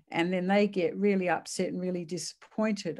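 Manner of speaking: normal talking voice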